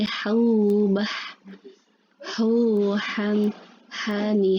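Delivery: breathy "ha" from mid-throat, each syllable apart; reciting ha syllables. Shown as a demonstration. Teacher drilling the letter ha for students, breathy. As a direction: push air out on every "ha". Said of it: "udara yang keluar".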